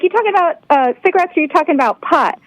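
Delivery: put-on high, sing-song Swedish-accented child. Retelling the girl's cigarettes-or-pot question, high Swedish-accented voice. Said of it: "Yes, definitely Swedish".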